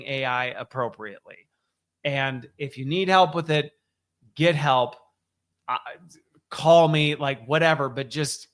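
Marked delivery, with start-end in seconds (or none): none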